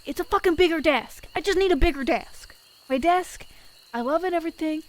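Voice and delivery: exasperated. Real, not acted. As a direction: vent the frustration. Exasperated desk rant.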